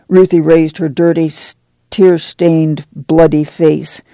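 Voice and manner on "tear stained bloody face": usual speaking voice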